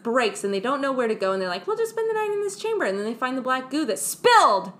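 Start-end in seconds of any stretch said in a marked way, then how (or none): 1.7-2.8 mock crew. Crew voiced high and fast.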